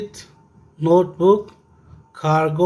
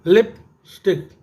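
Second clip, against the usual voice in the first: Lipstick slowly in two parts, लिप, then stick; lipstick split into two beats; syllable by syllable; slower than usual. Shown as a demonstration. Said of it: "ये टू syllable हो गया".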